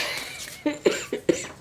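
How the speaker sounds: high unvoiced squeal of laughter. Real, not acted. Laugh attack.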